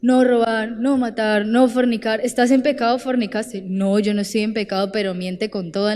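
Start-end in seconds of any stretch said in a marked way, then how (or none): none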